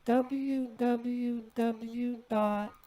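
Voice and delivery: letters repeated back slowly; slow, careful letter by letter; slow dictation. Real, not acted.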